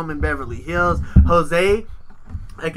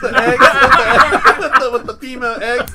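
high, loud, slow, laughing through words; can barely get it out laughing; cracking up; 7 semitones higher, 9 dB louder, slower than usual. Real, not acted. Joking about fan mail, laughing hard.

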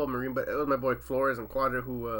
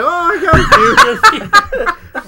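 laughing; 14 dB louder, much slower than usual. Retold or imitated. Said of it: "I was like".

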